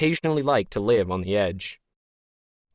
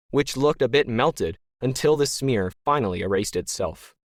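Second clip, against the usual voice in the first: usual speaking voice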